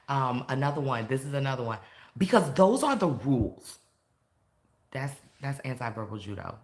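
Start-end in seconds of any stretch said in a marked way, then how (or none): 2.2-3.8 mock officious, louder with a raised swoop; bossy authority. Shown as a demonstration. Teacher demonstrating an anti-verbal judo phrase, bossy. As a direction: lay down the law, loudly.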